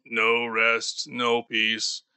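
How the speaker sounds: usual speaking voice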